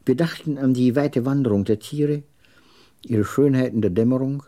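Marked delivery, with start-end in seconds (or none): none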